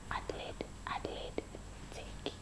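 barely voiced whisper; whispered call. Boy whispering to her across the exam desks. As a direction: pass the paper without being seen.

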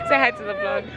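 high, giggly call to say hi; laughing. Real, not acted. Urging a shy friend to greet the vlog, laughing.

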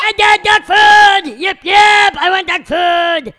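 loud, frantic demanding shout; hyper shouting. Acted. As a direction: yell for food like a wound-up puppy. Hyper dog demands food.

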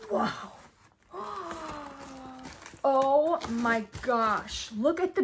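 slow, drawn-out "Oh my gosh" after a gasp; awed gasp. Real, not acted. Vlogger opening the finale box, awed and slow. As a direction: let the awe stretch out.

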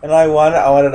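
high, loud, slow delighted gush; gushing excitement. Real, not acted. Friend gushing to the others about pony board games, excited.

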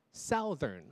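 SOUTHERN slowly as "SOW-thern"; wrong OW vowel. Shown as a demonstration. Teacher showing what not to say, "SOW-thern". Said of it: "不是southern".

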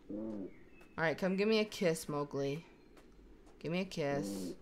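soft, low, loving goodbye; tender farewell. Pretend. Mother kissing her cubs goodbye, tender and quiet.

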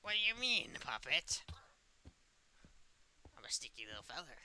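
ordinary delivery

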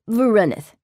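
loud, high, smug announcement; smug declaration. A narrator's reading. Demon gloating to narrator over his card win, smugly. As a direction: crow the win proudly. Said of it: "he declared smugly".

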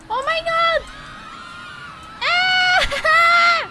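high, loud shriek of alarm; high playful-panic screaming; panicked scream. Real, not acted. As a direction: cry out in sudden panic. Panic as chase starts.

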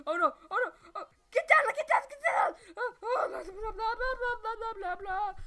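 very high, loud frantic yelling; frantic shouting. Real, not acted. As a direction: shriek in panic. Frantic panic in game.

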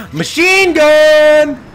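long, high drawn-out shout; loud, slow triumphant yell; excited holler. Real, not acted. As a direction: stretch it into a gleeful battle cry. Delighted by new weapon.

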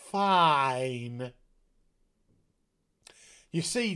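ordinary delivery

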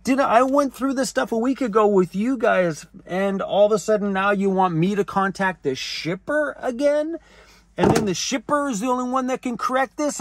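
high-pitched indignant retelling; mad protest. Retold or imitated. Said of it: "I got mad with her".

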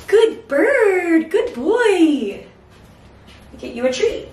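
high, sing-song praise for the bird; pet praise. Real, not acted. Praising the bird after flight.

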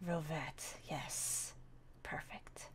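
a soft whispered perfect; whispered agreement. Acted. Friend whispering approval of the dog name. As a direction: breathe it out as a secret.

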